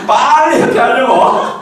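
slow, loud laughing words. Real, not acted. Laughs at clever kids.

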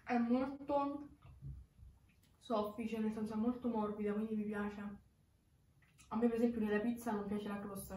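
speaking between bites of pizza; talking while chewing. Real, not acted. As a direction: mouth partly full of food. Seen 0: pizza held at mouth, lips moving as if chewing while speaking.

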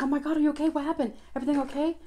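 concerned fussing; worried friend. Retold or imitated. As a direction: check on her friend in a rush.

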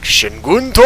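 put-on Shingunto barked in a heavy Japanese accent. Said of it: "a heavy Japanese accent".

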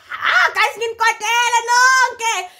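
high, drawn-out indignant yell; loud outburst. Retold or imitated. Narrator to friends partying without her, loud indignant outburst. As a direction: yell it high, stretching the words.

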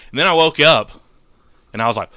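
normal talking voice

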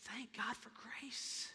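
slow soft whisper; whispered thanks. Real, not acted. Preacher whispering thanks to the congregation after shouting. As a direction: breathe it out in relief.

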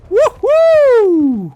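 loud, very high, long "woohoo"; triumphant whoop. Real, not acted. Gamer showing off his feat, triumphant whoop. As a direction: whoop it out at full height.

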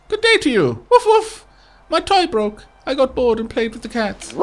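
put-on high, bright child voice; childlike villager. Toymaker child explains the broken toy, high childlike voice. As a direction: chirp it like a little kid. Reads NPC dialogue.

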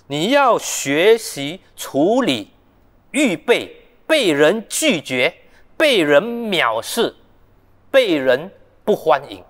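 each phrase spaced out, very slow; slow deliberate warning. Acted. Preacher voices Jesus warning would-be disciples, slow and deliberate. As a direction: lay out each hardship one by one.

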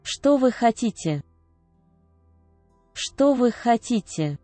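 ordinary talk

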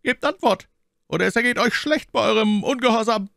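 lightly voiced officer, stern and high; commanding officer. A narrator's reading. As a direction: threaten them with cool authority. Officer threatens the musketeers.

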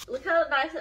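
ordinary talk

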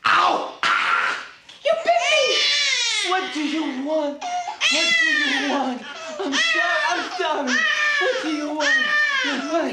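high, pained and frantic pleading; frantic yelp. Acted. Father bitten by crying baby, frantic pleading. Baby bites dad, he yelps.

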